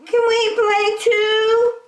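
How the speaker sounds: very high, slow, loud lamb voice; Lambkin's voice. A narrator's reading. Lambkin asks to join in, high and slow. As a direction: ask sweetly like a little lamb. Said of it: "asked Lambkin".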